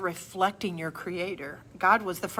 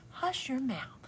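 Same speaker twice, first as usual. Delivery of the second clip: slow whispered, a touch higher; soft hush; 7 semitones higher, 6 dB quieter, slower than usual. Shown as a demonstration. Vlogger shows a softer hush: slow whisper. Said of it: "we can kind of soften it".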